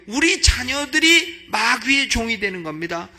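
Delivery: loud, high, slow preacher's cry; rising sermon shout; preaching at full force. Acted. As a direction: thunder it out to the congregation. Preaching climax.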